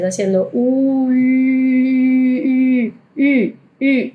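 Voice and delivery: "U" held with rounded lips, thinking i; ü sound; rounded ü drill. Shown as a demonstration. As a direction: repeat "U" as a German ü. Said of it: "pensando en el sonido de U".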